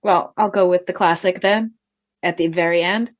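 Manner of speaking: ordinary delivery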